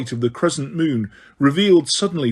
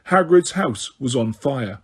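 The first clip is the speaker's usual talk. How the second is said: ordinary delivery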